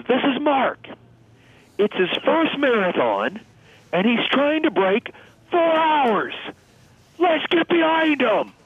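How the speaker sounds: retold crowd yell. Pacer rallying marathon crowd for struggling runner, high mock yell. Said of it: "he screams to the crowd".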